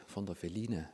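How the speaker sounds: soft, small voice, playing sheepish; meek quiet answer. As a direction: answer softly like a caught child. Meek replies to the Holy Spirit.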